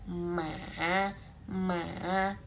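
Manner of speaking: "Ma" drawn out in a gentle dip; dipping third tone. Shown as a demonstration. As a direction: sink on MA and lift the end slightly. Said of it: "nada tiga itu turun dulu".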